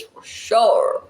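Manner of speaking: usual speaking voice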